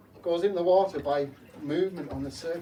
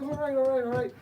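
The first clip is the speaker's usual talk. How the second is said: placating reply; 9 semitones higher, 4 dB louder than usual. Acted.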